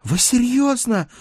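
very high, slow, incredulous delight; overjoyed gasp. A narrator's reading. Page hears he may have a date, breathlessly happy. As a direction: gasp it out with sheer happiness. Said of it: "задохнулся от счастья мой прихреневший паж".

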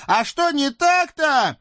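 very high squeaky devil voice, pleading; a scrawny devil begging off; whiny little devil. Acted. Наум обижен. (писклявый чёрт)

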